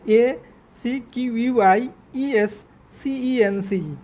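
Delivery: A C Q U I E S C E N C E spelled slowly, letter by letter. Shown as a demonstration. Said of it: "বানানটা একটু খেয়াল করবেন". (ধীরে ধীরে প্রতিটি অক্ষর আলাদা করে বানান বলা)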